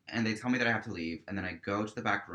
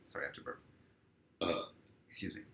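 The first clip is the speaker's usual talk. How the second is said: softer whispered apology; whispered aside; 4 semitones lower, 8 dB quieter, much faster than usual. Real, not acted. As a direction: drop to a private whisper mid-story. Aside before burping.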